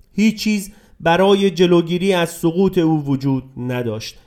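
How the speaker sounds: plain reading voice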